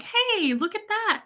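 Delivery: very high, cooed attention-getter; retold baby coaxing. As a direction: re-enact distracting a crying infant. Re-enacting distracting a crying baby.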